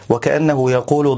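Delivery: normal talking voice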